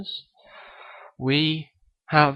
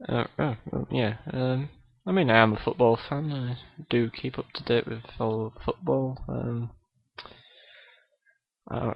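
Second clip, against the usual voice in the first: ordinary talk, nothing marked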